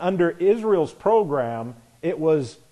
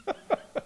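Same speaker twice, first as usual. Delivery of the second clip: soft laugh; 10 dB quieter than usual. Real, not acted. Preacher laughs at his own voicing.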